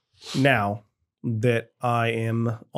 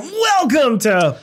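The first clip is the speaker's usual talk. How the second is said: imitating announcer; 12 semitones higher, 3 dB louder, much slower than usual. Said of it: "We went from".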